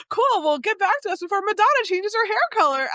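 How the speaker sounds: high, rushed Valley Girl imitation; Valley Girl accent. Improvising prank caller wrapping up, mock Valley Girl accent. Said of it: "she puts on that Valley Girl accent".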